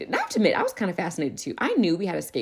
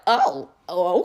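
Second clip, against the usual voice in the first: taken aback; 6 dB louder than usual. Retold or imitated. Boy claims he'll be first.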